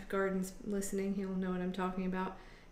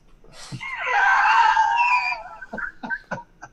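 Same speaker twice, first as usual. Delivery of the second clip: big high laugh; 12 dB louder than usual. Real, not acted. Laughing after uncle joke.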